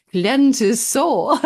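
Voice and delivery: drawn-out, lightly Italian-accented imitation. Quoting a Camino-addicted friend's catchphrase in a light Italian accent. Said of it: "as he would say in his, uh, Italian accent".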